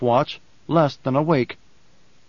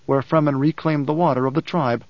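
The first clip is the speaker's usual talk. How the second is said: ordinary delivery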